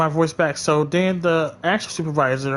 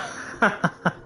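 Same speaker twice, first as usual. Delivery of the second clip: breathy chuckle; laugh; 6 semitones higher, 3 dB quieter than usual. Real, not acted. Laughs after her aside.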